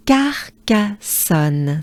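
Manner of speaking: Carcassonne again, slow and even. Shown as a demonstration. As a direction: repeat Carcassonne at a slow pace.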